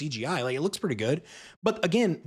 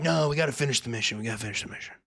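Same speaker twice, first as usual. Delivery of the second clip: quick, lower-pitched dismissal; mock stubborn insistence; 7 semitones lower, 4 dB quieter, much faster than usual. Crew answering the worried survivors, mock stubborn. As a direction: brush off the objection, fading softer. Crew's retold reply, low and quick.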